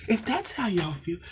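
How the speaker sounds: ordinary talk, nothing marked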